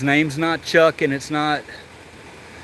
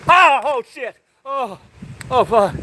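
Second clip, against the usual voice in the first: startled yelp; 11 semitones higher than usual. Real, not acted. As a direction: jump at the lizard and yelp. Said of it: "I just yelled out".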